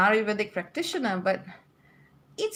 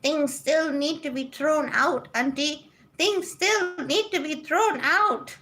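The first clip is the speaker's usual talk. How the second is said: lightly voiced cat, slow and higher; voicing the cat; 6 semitones higher, slower than usual. Host voicing her cat after it puked, lightly whiny. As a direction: speak as the cat, slowly complaining. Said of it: "So she's saying".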